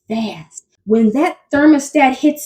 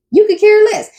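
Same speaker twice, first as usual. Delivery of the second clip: indignant; 9 semitones higher, 4 dB louder than usual. Real, not acted. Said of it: "indignant repeat".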